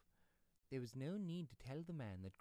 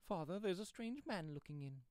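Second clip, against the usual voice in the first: higher, louder cry of warning; sudden alarm; 8 semitones higher, 6 dB louder than usual. Acted. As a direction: call out to father, startled and alarmed. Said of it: "with sudden alarm".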